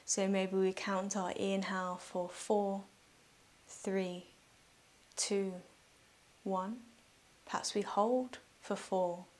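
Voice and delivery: soft, unhurried meditation voice with long pauses; guided meditation. Real, not acted. Yoga teacher guiding listeners through breathing, calm guided meditation.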